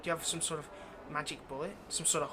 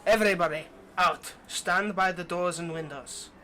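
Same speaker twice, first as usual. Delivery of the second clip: loud, firm order to the room; commanding call; 10 dB louder than usual. Acted. Vampire orders thralls to the doors, commanding call. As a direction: call out like a master to servants.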